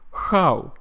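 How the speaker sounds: Russian-accented "how" starting with kh. Shown as a demonstration. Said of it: "A Russian person would say".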